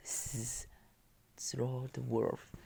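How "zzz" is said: snoring. A narrator's reading. Wolf snoring.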